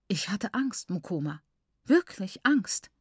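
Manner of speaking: plain reading voice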